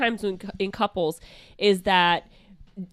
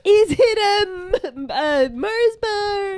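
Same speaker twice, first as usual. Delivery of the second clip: high squeaky voice; 12 semitones higher, 4 dB louder than usual. Pretend. Baby shower game mock.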